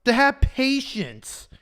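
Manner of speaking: God answering. Acted. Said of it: "God's like".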